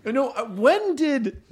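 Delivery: high, laughing come on; can't believe it, laughing through it; laughing disbelief. Real, not acted. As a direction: friend laughing in mock protest.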